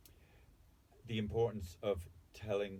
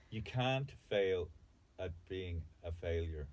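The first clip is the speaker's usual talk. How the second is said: slow emphasis; 3 semitones lower, slower than usual. Real, not acted.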